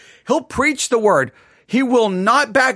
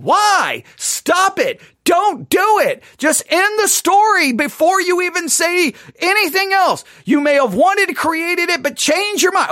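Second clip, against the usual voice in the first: higher pleading outcry, half-hearted scream; mock scream at God; 6 semitones higher than usual. Preacher reading Genesis, mock screaming at God to stop. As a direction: beg God to stop, pitched up high. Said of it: "I start screaming".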